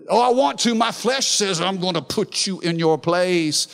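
high, loud burst of pretend temper; mock angry urge. The flesh itching to tell someone off, mock angry outburst. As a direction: act out the itch to blast someone.

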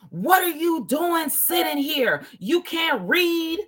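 imitating a harsh policewoman shouting; angry policewoman. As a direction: snap at the driver like an irritated cop. Retold policewoman confronting him.

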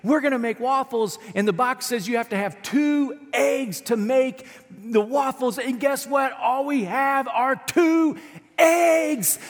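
put-on high, bright child voice that climbs on eggs; little girl. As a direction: act the proud daughter with the waffle box. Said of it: "And she goes".

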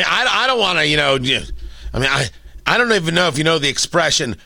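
normal talking voice

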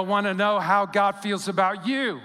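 normal talking voice

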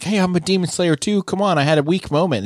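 high-pitched mock protest; Murata's protest. Murata protests to angry Inosuke.

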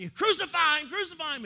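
loud, high crowd chant; mob shouting. Acted.

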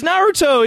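Naruto said high and clear; plain Naruto. Shown as a demonstration. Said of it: "Just say it how it is".

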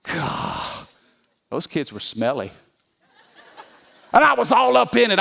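usual speaking voice

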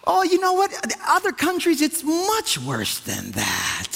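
mock condescending brush-off; dismissive. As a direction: act out a know-it-all minimiser. Acts out minimising with a comparison.

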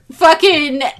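ordinary talk

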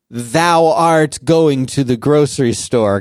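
slow, stilted imitation of old-fashioned language; mock archaic speech. Uncle mocking Quebec French, in mock archaic speech. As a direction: put on a drawn-out antiquated voice. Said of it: "what does it sound like".

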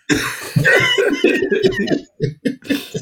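a long, high burst of real laughter; big high laugh. Real, not acted. Laughing at own boast.

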